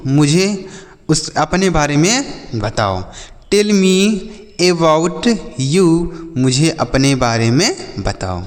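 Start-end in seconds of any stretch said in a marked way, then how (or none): 3.5-5.9 each English word said slowly for the class; slow model sentence. Shown as a demonstration. Teacher to children, modelling an English sentence slowly. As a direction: spell it out slowly for learners.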